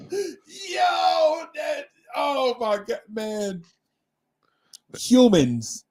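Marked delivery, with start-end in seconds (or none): 0.0-3.6 high, shrieking laughter breaking into words; can barely speak for laughing; laughing hard. Real, not acted.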